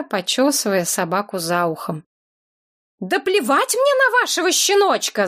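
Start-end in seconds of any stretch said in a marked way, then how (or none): 3.0-5.3 high-pitched mock shout. A narrator's reading. Woman yelling at the dog owner, high-pitched shout. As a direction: yell it in a high frantic voice. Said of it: "закричала я".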